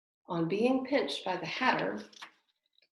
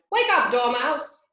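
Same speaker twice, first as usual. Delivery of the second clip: calling to wake; 6 semitones higher, 8 dB louder than usual. A narrator's reading. Waking the sleeping Dormouse.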